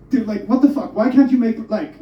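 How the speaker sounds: ordinary delivery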